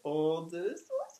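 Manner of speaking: high, fast cheek-pinching coo; cooing baby talk. Retold or imitated. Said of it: "klype i kjekene og si".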